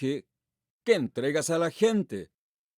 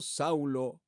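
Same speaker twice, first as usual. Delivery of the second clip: "Saulo" lower and much softer, like a fading echo; 4 semitones lower, 6 dB quieter than usual. Acted. Preacher voicing Jesus calling Saul, a soft low echo of the name. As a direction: let the second "Saulo" fall away low and quiet.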